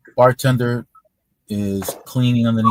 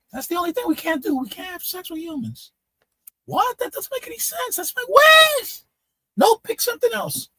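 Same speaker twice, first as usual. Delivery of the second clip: high-pitched little guy. Retold or imitated. Tiny-headed man haggling with the mermaid, high squeak. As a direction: squeak the bargaining to the punchline. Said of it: "The guy has a really high-pitched voice".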